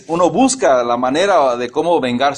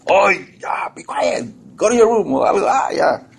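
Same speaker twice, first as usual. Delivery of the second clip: regaño a niños imitado; 6 semitones higher than usual. Retold or imitated. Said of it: "los niños nos hacen hacer eso".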